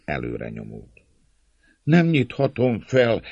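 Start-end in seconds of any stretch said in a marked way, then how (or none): 1.8-3.2 slow, high, frightened refusal; trembling voice. A narrator's reading. Gatekeeper refusing the mob, trembling. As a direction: answer fearfully from behind the gate. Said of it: "válaszolt egy remegő hang a kapu mögött".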